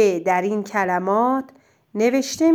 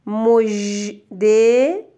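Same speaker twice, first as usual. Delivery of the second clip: each word drawn out very slowly; slow dictation; much slower than usual. Shown as a demonstration. Teacher dictating words for the pupil to write. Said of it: "بنویس".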